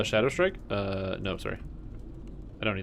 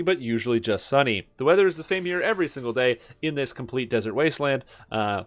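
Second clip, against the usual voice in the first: upbeat TV weather forecast; weatherman voice; 3 semitones higher, 4 dB louder than usual. Pretend. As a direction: present it like a local weather anchor. Continues pretend weather segment.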